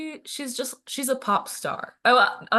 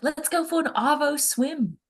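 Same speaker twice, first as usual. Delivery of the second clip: higher, slow imitation of an Australian; Australian accent; 6 semitones higher than usual. Quoting a favorite YouTuber to friends, Australian accent. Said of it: "So Australian".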